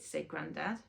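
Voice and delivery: plain reading voice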